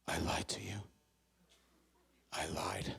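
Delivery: low, soft whispered admission; whispered confession. Retold or imitated. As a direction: confess it under your breath. Matt's hushed confession.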